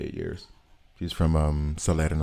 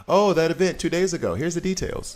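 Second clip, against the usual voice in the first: mock announcement; 10 semitones higher, 4 dB louder than usual. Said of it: "it's like".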